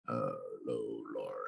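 croaky, hoarse allergy voice; frog voice. Real, not acted. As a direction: croak it out, low and rough. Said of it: "my voice sounds like a frog today".

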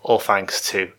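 ordinary talk, nothing marked

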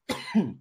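short dry cough, clearing the throat. Real, not acted. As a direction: cough as if something went down wrong. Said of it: "engasguei aqui". (tosse seca)

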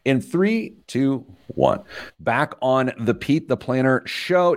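radio host countdown. Acted. Host counting back into the show, high and showy. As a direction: announce the return like a DJ.

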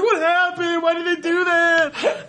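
put-on high wailing little kid voice; frightened crying child. The kids after the foghorn blast, high crying child's voice. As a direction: play a scared crying child.

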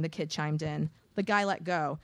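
ordinary delivery, nothing marked

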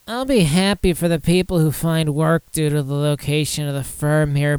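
whiny nasal commenter. Acted. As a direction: whine it out like a complainer. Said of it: "I'll give him a whiny voice".

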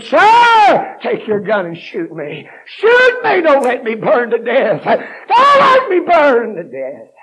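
screamed high and loud, begging for death; desperate screaming plea. Retold or imitated. Preacher voicing the trapped driver begging the trooper, screaming in terror. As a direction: cry out in terror with a breaking voice. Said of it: "He asked that state trooper".

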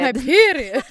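put-on grand fancy made-up accent; pompous Renaissance woman. Host outdoing her friend's fancy version, pompous accent. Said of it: "You sound like a pompous Renaissance woman".

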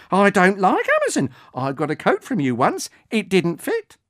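put-on baffled old woman. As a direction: scoff at the drone and at Amazon. Margaret baffled, then grumbling.

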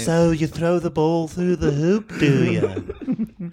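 put-on bemused British accent, amused; mock-polite British questioning; Jon Ronson. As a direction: ask like a baffled British interviewer. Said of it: "Jon Ronson talks exactly like a guy".

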